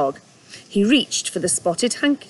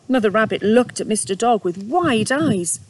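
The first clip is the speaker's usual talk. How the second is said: usual speaking voice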